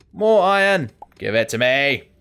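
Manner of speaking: greedy villain gloat, loud; hammy evil overlord claiming treasure; megalomaniac rant. Pretend. Greedy gloating over found iron.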